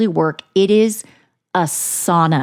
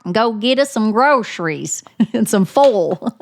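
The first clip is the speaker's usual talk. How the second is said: mock-mad Southern mama ordering a kid around; hammed-up Southern scolding; 5 semitones higher than usual. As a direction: play up a twangy Southern telling-off. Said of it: "when I'm mad".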